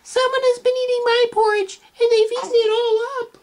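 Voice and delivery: very high, loud squeaky wail; high loud baby bear cry; tiny Baby Bear voice. Acted. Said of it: "cried out in his tiny little voice".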